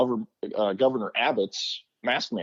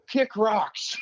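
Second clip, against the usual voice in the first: dismissive; 10 semitones higher than usual. Retold or imitated. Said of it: "I go".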